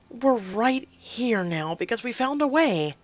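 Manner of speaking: loud, high and slow; angry insistence. Acted. Woman argues back at desperate partner, angrily insistent. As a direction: hammer the point home emphatically. Acted quarrel, arguing back.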